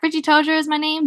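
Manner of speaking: playful, lifted self-introduction; showy introduction. Acted. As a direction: announce yourself with a flourish. Richie introduces himself with a flourish.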